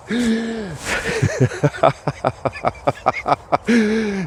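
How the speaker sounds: long helpless fit of giggling; can't stop laughing at the memory; real laughter. Real, not acted. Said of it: "I'm sorry if I'm laughing".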